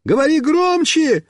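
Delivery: high, calling shout; high-pitched yelled reply. A narrator's reading. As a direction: holler back while running after someone. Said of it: "Мишка побежал за мной и кричит".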